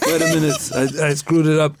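normal talking voice

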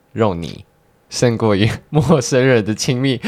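slow, hesitant poem reading. Acted. Reads own poem aloud.

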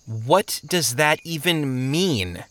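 slow, drawn-out puzzled question; baffled. Acted. Traveler asks about a senseless saying, baffled. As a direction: stretch it out in disbelief.

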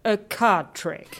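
slow, deflated disbelief; unimpressed. Acted. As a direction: let the air out of his boast. Unimpressed echo of the boast.

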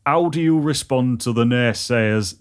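put-on slow Teesside accent reading; Redcar accent. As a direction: northeast England accent with a bit of Yorkshire. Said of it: "I'll give it a go".

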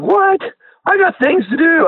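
high, mock-annoyed friend brushing him off. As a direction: squeak out the friend's grumpy protest. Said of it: "And he's like".

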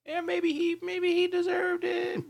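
mock high voice. Mock verdict on abusive dad.

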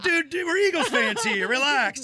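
high-pitched mock appeal to calm down; pleading protest. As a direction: plead high with a fellow fan. Retells his plea to angry fan.

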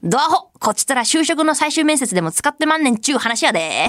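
hammed-up fake Kansai-ben retort; fake Kansai dialect. Radio host reading a listener's joke mail, fake Kansai dialect. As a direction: lay on a phoney Kansai accent. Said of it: "エセ関西弁使ってるの指摘された".

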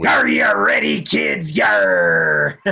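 deep shouted pirate captain call. Pretend. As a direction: roar it like a ship's captain rallying kids. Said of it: "Oh, I just wanted the, uh, the pirate voice".

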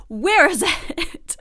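where is it laughed out slowly; laughing. Real, not acted. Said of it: "laughing repeat".

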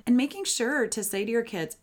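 usual speaking voice

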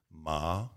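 "Ma" sliding upward; "Ma" with a questioning rise; rising second tone. Retold or imitated. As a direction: imitate a teacher lifting "Ma" like a question. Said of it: "second tone".

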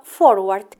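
normal talking voice

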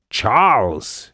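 mild mock roar of a name; a hammed-up bellow for show. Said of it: "he roared in a voice that shook the room".

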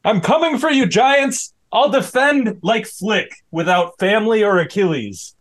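fired up and charging; pumped battle cry. Acted. Rudy charges back to court.

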